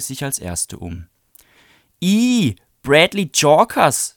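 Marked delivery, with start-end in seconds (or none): 2.0-4.1 high, loud and slow, nose wrinkled in disgust; disgusted sneer. Acted. Lori grimacing at Bradley on the street, disgusted and drawn out. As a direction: drag his name out with a grimace. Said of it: "sagte sie und verzog das Gesicht".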